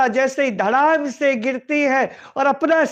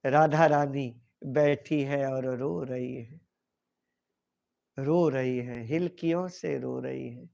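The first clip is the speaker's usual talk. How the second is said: low, much softer, near-broken narration of Radha sobbing; hushed tearful telling; 11 semitones lower, 9 dB quieter than usual. A narrator's reading. Storyteller describing Radha weeping, hushed and tearful. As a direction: let the voice sink as if tears are close.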